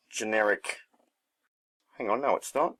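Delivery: ordinary delivery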